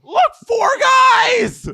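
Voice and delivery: very high, drawn-out shriek; shrieked outcry. Real, not acted. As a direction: cry out in high-pitched disbelief at the play. Shrieking reaction to the hockey play.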